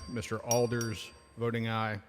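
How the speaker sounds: ordinary talk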